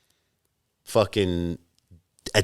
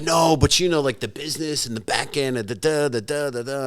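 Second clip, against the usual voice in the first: higher, blah-blah imitation; mimicking a pitch; 7 semitones higher than usual. Imitating partners selling him on the business, mimicking. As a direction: gloss over their eager sales patter.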